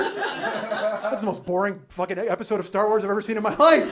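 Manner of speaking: loud comic rant building to a high yell; hammed-up exasperation, exploding on the last word; mock outrage. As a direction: build to an indignant shout. Mock rant about beeping scanner.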